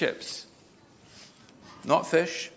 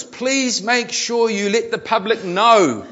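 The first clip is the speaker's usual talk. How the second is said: loud, high, slow mock yell; all-caps shouting; 9 semitones higher, 7 dB louder, slower than usual. A narrator's reading. Customer posts in capitals about shops shutting, mock shouting. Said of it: "in capitals".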